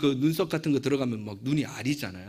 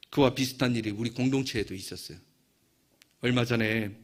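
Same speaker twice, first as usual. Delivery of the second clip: normal talking voice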